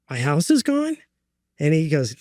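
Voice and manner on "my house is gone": high-pitched, stunned question; retold disbelief. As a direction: echo the news as a much higher question. Retelling shock at house loss.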